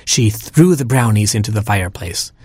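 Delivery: usual speaking voice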